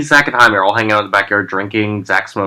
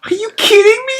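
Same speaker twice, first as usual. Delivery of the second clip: much higher, squeaky mock disbelief. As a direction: imitate a friend who can't believe it. Melissa reacting in disbelief.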